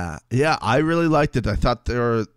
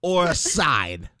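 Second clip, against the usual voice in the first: put-on Italian-American accent; 6 semitones higher than usual. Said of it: "We're going Italian".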